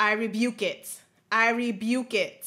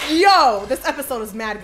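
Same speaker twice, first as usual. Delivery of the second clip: hyped exclamation; 10 dB louder than usual. Real, not acted. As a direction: burst out thrilled. Excited reaction to the episode.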